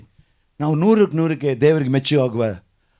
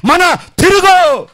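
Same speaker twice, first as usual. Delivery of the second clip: much higher, louder, slow roaring call; father's roar; 12 semitones higher, 6 dB louder, much slower than usual. Acted. God as father calling his child to repent, roaring. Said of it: "ಅಪ್ಪ ಕೂಗ್ತಾ ಇದ್ದಾನೆ".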